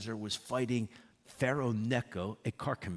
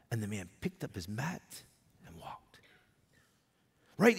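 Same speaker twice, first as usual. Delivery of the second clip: ordinary talk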